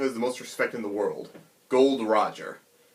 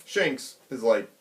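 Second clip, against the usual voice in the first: ordinary talk, nothing marked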